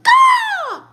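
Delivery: very high, loud crow screech; loud, high-pitched caw. Acted. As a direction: let out a piercing crow call. Legendary sound off, likes crows.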